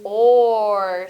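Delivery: "or" loud and drawn out; isolated vowel sound. Shown as a demonstration. Teacher naming the vowel she hears, "or" held loud. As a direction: punch and hold the sound "or". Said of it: "I hear the".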